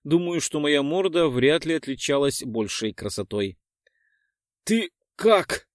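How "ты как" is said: exhausted breathy ask; breathless. Acted. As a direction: gasp it out weakly. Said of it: "выдохнул я". (выдох без сил)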